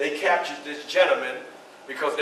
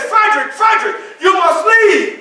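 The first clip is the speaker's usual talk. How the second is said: high, loud cry of alarm; urgent shouted warning; 13 semitones higher, 10 dB louder than usual. Acted. Mister Garrison bursting in to warn Frederick, shouting urgently. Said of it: "And he says".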